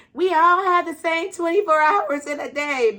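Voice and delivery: high, loud, mocking the saying; loud mocking quote of a cliché; mock preachy quote. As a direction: parrot the cliché loudly and high. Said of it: "when you say".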